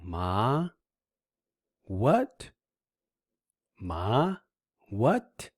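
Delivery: MA and WHAT each gliding upward; rising second tone. Shown as a demonstration. Teacher matching ma to a surprised what, rising tone. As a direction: lift MA like the question WHAT. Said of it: "This is called the rising tone".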